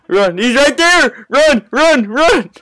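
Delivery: high frantic yells; panicked screaming. Real, not acted. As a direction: scream run in terror. Monster appears, team fleeing.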